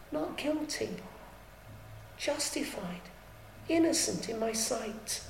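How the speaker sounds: preacher softly voicing God's acquittal; solemn verdict. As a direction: let the verdict fall gently.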